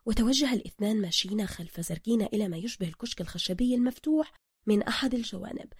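ordinary delivery, nothing marked

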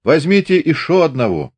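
loud, merry call from the doorway; cheerful shout. Acted. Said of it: "весело крикнул".

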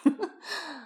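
short, high laugh. A narrator's reading. Skater lets his partner go, laughing. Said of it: "Astarion, laughing".

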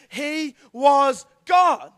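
slow, loud declaration; God spoken in a big ringing shout; emphatic shout. Real, not acted. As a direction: proclaim it slowly with full force. Preacher's climactic declaration.

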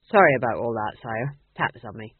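low-pitched soldier's apology; apologetic sergeant. Acted. Sergeant apologising to king after misunderstanding, apologetic. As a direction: drop the voice and apologise.

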